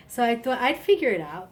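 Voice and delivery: ordinary delivery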